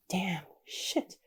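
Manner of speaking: whispered shocked reaction; hushed swearing. Real, not acted. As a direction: swear under your breath at something gruesome. Reacts to gruesome drill scene.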